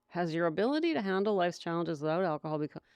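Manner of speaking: rushed reread. Shown as a demonstration. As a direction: rush through the question. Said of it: "Let's read that one again".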